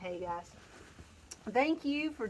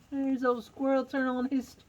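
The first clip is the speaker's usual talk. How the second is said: ordinary delivery, nothing marked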